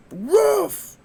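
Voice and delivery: high yappy woof; imitated dog bark. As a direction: bark like a small eager dog. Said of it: "he'd be like".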